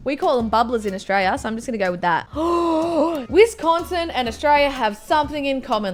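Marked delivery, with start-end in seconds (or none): 2.3-3.2 sharp gasp. Acted. Quiz player hearing the answer, surprised gasp.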